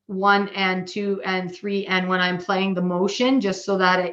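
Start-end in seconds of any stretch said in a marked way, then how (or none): 0.1-1.9 slow, spaced-out one and two and three; slow counting. Shown as a demonstration. Teacher showing how she counts while playing. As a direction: count it out slowly and evenly. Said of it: "I always tend to count that".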